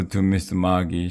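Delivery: ordinary delivery, nothing marked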